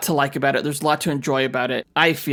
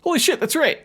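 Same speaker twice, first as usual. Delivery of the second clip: high excited burst; suddenly remember, thrilled; excited exclamation; 10 semitones higher than usual. Real, not acted. Excited realization, high pitch.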